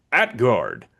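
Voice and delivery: punchy neutral-site lineup call; arena announcer, emphatic without cheering; neutrally emphatic announcer. Shown as a demonstration. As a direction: announce the starter with measured punch, lifting key details. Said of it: "I wanted to be neutrally emphatic".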